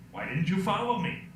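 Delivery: ordinary talk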